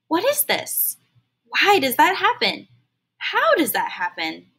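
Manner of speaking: a hint of an eager kid firing questions; young Al pestering his mother with questions; curious child. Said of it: "encouraged him to ask questions".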